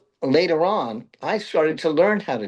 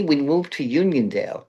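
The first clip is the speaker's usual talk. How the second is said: usual speaking voice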